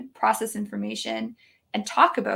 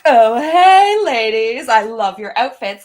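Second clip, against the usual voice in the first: bursting in with a cheesy compliment; hammed-up salesy charm; flirty joking compliment; 10 dB louder, slower than usual. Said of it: "humor compliment package".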